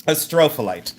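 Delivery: "astrophyllite" as uh-STRO-fuh-lite; "astrophyllite" rhythm like a-STROPH-a-lite; stress on second syllable. Shown as a demonstration. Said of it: "That's the way I pronounce it".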